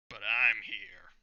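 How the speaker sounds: loud, slow, heavy declaration; booming entrance line. Acted. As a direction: announce it like a hero landing. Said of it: "I wanted to do this in a certain way".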